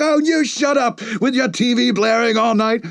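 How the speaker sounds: high mock shout at the neighbor; yelling back. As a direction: snap back through the wall, hammed-up.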